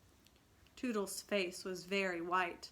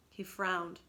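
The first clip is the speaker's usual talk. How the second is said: plain reading voice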